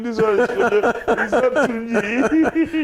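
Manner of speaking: high, laughing through the words; laughing excuse. Retold or imitated. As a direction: chuckle the excuse away, caught out. Kwesi laughs off the crowd.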